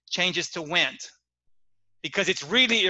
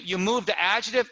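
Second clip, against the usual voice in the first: ordinary delivery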